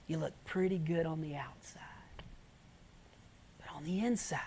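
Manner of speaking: soft whispered warning; hushed whisper. Retold or imitated. Paul quoted to Romans, hushed.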